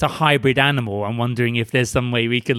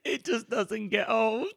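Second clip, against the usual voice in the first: laughing; 8 semitones higher, 4 dB quieter, slower than usual. Real, not acted. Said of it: "I'm crying".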